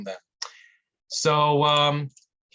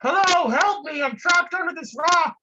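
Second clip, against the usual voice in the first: much higher and louder, frantic cries for help; panicked trapped man; 4 dB louder than usual. Acted. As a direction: yell up from under the rock, desperate. Trapped man yells again.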